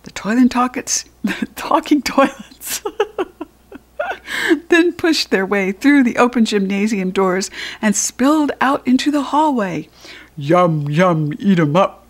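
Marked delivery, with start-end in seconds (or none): 4.0-4.6 high burst of laughter; real laugh. Real, not acted. Narrator cracks up at slip. 10.4-12.1 hungry monster chant, lightly voiced; toilets bellowing. A narrator's reading. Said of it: "the toilets bellowed".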